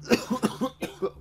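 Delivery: high strained gagging cough. Acted. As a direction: choke back a retch. Gagging at the vomit.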